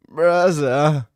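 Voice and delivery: lightly voiced Dagestani accent on braza; Islam impression. Streamer showing chat his fighter impression, light Dagestani accent. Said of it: "that a good Islam impression?".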